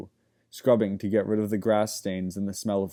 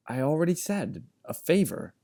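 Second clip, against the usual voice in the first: put-on boy's voice; 6 semitones higher than usual. A narrator's reading. Wilk, a boy, replies.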